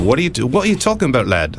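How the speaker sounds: high, quick, badly faked English accent. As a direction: play a baffled English guide, accent wobbly. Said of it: "that's not a good accent either".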